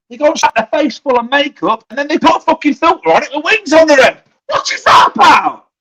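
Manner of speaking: rising into an angry shout; starts fed up and ends shouting in outrage; building rant. Real, not acted. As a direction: rant that climbs into a yell. Ranting about filters and makeup.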